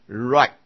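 RIGHT drawn out, then a light separate T; light final T. Shown as a demonstration. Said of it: "特也是很轻的音了哈". (慢读right，词尾T轻轻带出)

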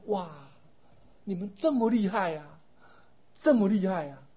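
wide-eyed admiring cry; awed exclamation. Retold or imitated. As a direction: exclaim like someone dazzled by a miracle. People marvel at buddhas' powers.

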